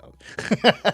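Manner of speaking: slow, loud, laughing shut up; laughing disbelief. Real, not acted. Host scoffing at friend's take, laughing. Mock outrage at 'tanked' claim.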